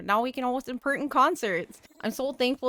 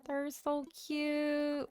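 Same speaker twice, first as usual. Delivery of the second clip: cooing; 4 semitones higher, 7 dB quieter, much slower than usual. Real, not acted. Cooing over her villagers.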